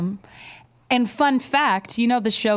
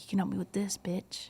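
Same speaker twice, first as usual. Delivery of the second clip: hushed, voiceless menace in her ear; whispered threat; 13 dB quieter than usual. Pretend. Taunting a shop clerk in a made-up scene, whispered. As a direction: breathe the words as a sly threat.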